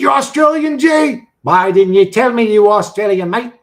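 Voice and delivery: loud, high, excited shout; mock outrage. Streamer to a viewer revealed as Australian, loud mock outrage. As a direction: holler it in playful indignation.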